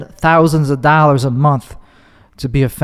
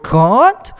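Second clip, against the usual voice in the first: put-on Australian accent; 6 semitones higher than usual. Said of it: "their voice raises at the end of every word".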